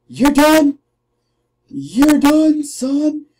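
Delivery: high, loud "you're done" yell; trash-talking the enemy, yelling; mock threat. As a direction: shout down the opponent.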